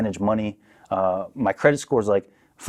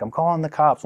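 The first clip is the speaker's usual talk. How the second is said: lightly voiced wife, higher pitch; imitated wife's threat; 7 semitones higher than usual. Wife threatening husband after thrown bottle, lightly mimicked threat. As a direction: mimic her warning, pitched up. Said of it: "she's like".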